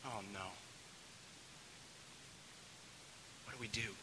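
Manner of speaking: soft, low, sinking voice; subdued and at a loss; hushed dismay. Acted. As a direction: break bad news quietly. Family absorbs the bad news.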